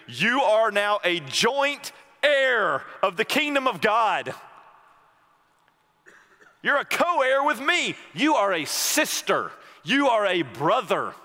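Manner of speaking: high, loud impassioned preaching; preaching at full force. Acted. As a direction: proclaim it, building to a climax. Preaching Jesus' words at full force.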